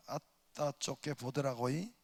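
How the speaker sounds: light Jeolla accent with 아따; mild Jeolla country lilt; lightly voiced Jeolla dialect. Shown as a demonstration. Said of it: "전라도 말은".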